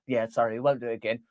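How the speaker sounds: low, soft, fast sheepish apology. Acted. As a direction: mumble a fake sorry. Agency apologising in skit.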